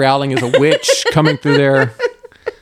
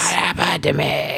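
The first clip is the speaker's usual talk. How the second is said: raspy voice impression; 6 dB quieter than usual. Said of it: "why the raspy voice".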